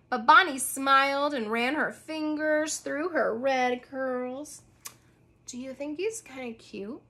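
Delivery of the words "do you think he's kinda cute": soft whisper. A narrator's reading. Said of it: "she whispered".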